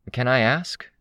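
high, tentative interjection; piping up. A narrator's reading. Narrator interjects to ask about symptoms, piping up. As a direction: pipe up with a question. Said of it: "I piped up".

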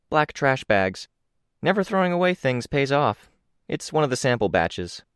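casual young woman, lightly voiced; Crystal's voice. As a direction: lift the pitch a little for the friend.

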